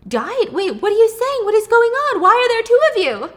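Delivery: rising into high loud panicked questions; the mother's confusion building into high, loud panic; shocked mom. Acted. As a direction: start bewildered, then climb into alarm. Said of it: "She's like".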